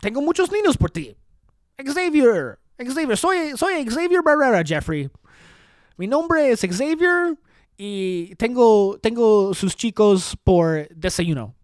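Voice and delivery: mock politician pitched high in Spanish; Xavier Barrera. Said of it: "I think Xavier goes".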